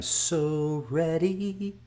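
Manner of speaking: high, very drawn-out excitement; giddy anticipation. Real, not acted. Fight fan waiting for Saturday, giddy anticipation. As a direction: stretch it out high, bursting with anticipation.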